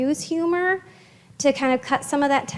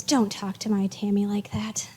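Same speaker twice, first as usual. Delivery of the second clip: soft playful whisper, breathy and quick; light whispered tease; 4 semitones lower, 6 dB quieter than usual. Retold or imitated. Jessie teasing Tammy for calling herself dumb, whispered playfully. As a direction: tease her gently under your breath. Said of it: "her tone was light, she was funny".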